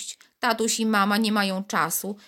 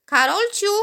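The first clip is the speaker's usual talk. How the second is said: high, louder call of the name; mother calling; 12 semitones higher, 6 dB louder than usual. A narrator's reading. Mother calls Karolcia to breakfast, loud call. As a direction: call the girl from another room. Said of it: "woła tymczasem mama".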